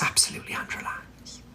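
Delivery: breathy whispered title. Acted. Host teasing the first segment, whispered aside. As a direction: whisper the name like a secret.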